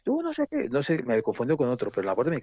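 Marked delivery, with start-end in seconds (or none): 0.0-0.7 high-pitched mock accusation; agitated stranger. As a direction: imitate an aggressor jabbering, voice way up. Retold stranger accosting the speaker. (imitando a un agresor, muy agudo)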